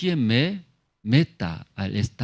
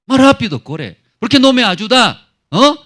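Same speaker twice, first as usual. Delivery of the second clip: high, loud angry yell; angry demand; 10 semitones higher, 8 dB louder than usual. Acted. Sick man angrily ordering helpers to hurry, high loud shout. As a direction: snap at them to run faster. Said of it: "Se enoja".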